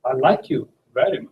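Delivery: like pushed up, rising at the end; stress on like. Shown as a demonstration. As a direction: linger on like, then rise. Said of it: "focus on like".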